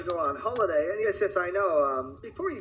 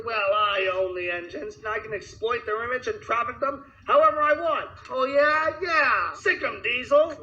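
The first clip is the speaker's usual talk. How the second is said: louder, gleeful gloat; 7 semitones higher, 5 dB louder than usual. Acted. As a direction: crow and egg the villain on. Boss sets diesel on him.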